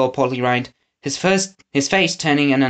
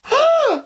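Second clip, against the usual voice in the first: sharp gasp. A narrator's reading. Winnie sees the wild-haired man.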